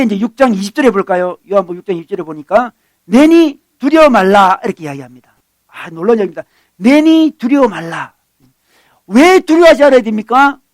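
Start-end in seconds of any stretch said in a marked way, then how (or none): 3.0-4.6 slow, loud, raised declaration; emphatic proclamation. Retold or imitated. Preacher as Jesus to frightened disciples, proclaiming loudly. 6.8-8.1 slow, high declaration; emphatic proclamation. Retold or imitated. Preacher repeating Jesus' words to the disciples, slow proclamation.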